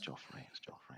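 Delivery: barely audible whisper; very soft, voiceless repeat; whispered aside. Real, not acted. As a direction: whisper the name under the others' talk. Whispered aside under another speaker.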